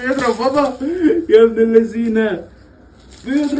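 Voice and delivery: playful scolding while laughing; amused protest at being hit. Real, not acted. Baby hitting dad, laughing.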